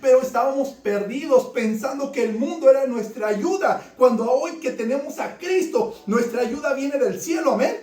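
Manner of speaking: full-force preaching. Acted.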